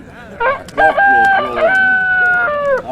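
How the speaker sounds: hugely high, loud, drawn-out crowing; cockerel crow. Retold or imitated. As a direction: crow the words like a rooster, very slow. Mimicking the cock crowing.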